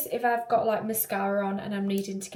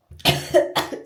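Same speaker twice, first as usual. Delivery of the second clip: harsh coughing fit; choking cough; 7 dB louder than usual. Real, not acted. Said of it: "I'm choking".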